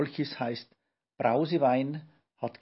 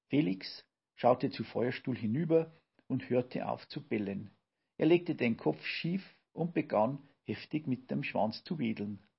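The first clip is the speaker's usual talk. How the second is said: ordinary delivery, nothing marked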